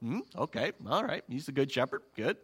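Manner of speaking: low, offhand murmur of agreement; quietly nodding along, softly accepting it; listeners going along. Acted. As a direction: mutter along as a half-convinced listener. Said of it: "everyone who's listening to him is going".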